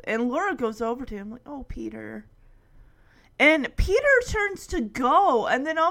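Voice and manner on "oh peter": soft, sympathetic sigh of a name; consoling. Retold or imitated. Laura goes over to Peter, soft consoling. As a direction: comfort him quietly.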